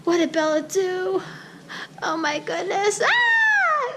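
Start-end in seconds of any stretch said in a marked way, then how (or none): 3.0-3.9 very high-pitched shriek; sudden high squeal; high scream. Real, not acted. During slime removal from hair, high scream.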